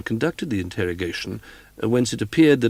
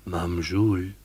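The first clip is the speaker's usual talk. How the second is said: ghost voice; 3 semitones lower, slower than usual. Retold or imitated. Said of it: "I heard a voice say".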